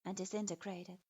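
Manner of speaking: ordinary delivery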